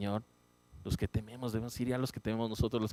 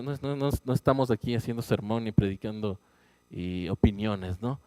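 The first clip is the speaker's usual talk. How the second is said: ordinary delivery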